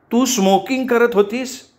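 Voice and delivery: high-pitched accusing question, contained shout; mock yelling. A narrator's reading. Roommate accusing over the alarm, mildly yelling. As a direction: shout it high without much volume. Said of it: "कान दाबत ओरडून विचारलं".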